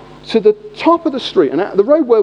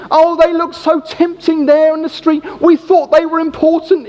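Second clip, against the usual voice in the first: sheepish excuse; 6 semitones higher than usual. Retold or imitated. Accomplice excuses himself.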